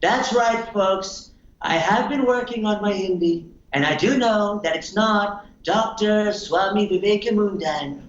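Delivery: mock American politician, emphatically self-assured; Trump. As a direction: pitch it up and stretch words for emphasis. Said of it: "And then Trump would be like".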